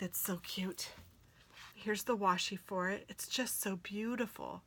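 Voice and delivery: ordinary talk, nothing marked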